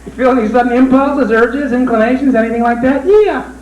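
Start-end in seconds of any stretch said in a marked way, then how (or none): 3.0-3.5 high squeaky rabbit puppet. Acted. Ventriloquist voicing his rabbit puppet, high puppet voice. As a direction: voice the puppet much higher.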